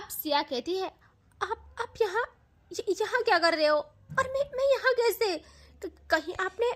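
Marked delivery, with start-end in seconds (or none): 1.4-6.7 high, stammering panic; panicked stammer. Acted. Siya panics waking in a stranger's room, high frightened stammer. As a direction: stutter out the fear, voice climbing high. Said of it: "वो घबरा के बाहर भागने लगती है".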